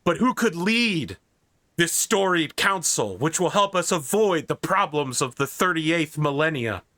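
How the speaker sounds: grand host building to a reveal; announcer introduction. Acted.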